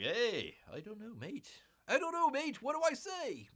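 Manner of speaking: high-pitched, hammed-up Australian accent; mistaken Australian accent. Imagining an actress improvising on set, mistakenly Australian accent. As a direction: put on a rough Australian, pitch climbing. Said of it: "that's Australian".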